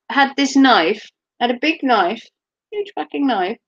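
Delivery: usual speaking voice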